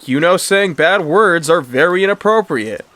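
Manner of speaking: high, loud lecture; indignant telling-off; scolding parent. A narrator's reading. Mister Lorraine keeps scolding Martha.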